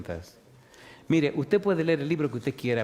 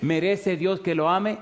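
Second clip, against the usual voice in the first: preaching at full force; 3 semitones higher than usual. Acted. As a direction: drive each yes-or-no question home to the flock. Preacher drills the congregation.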